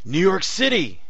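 loud, high-pitched call; yelling. A narrator's reading. Man naming a place to teleport to, yelling. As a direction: yell out the destination. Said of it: "he yelled".

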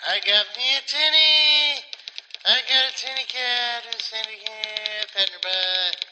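high, squeaky voice for the cat. Real, not acted. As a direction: coo to a kitten. Baby talk to her cat.

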